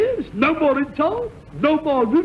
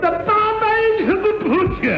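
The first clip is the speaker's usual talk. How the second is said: high, grand title announcement; raised announcer voice for the title; announcer style; 7 semitones higher than usual. Acted. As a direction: proclaim the title like a showman. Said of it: "announces his sketch title".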